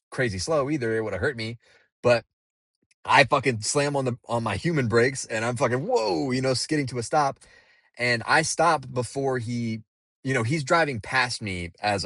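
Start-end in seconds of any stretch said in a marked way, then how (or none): 5.8-6.3 startled whoa. Retold or imitated. Skidding to a stop, startled.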